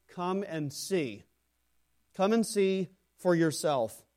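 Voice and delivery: drawn-out, deliberate come and see; slow it down and invite; a touch of Philip's slow, gentle invitation. Acted.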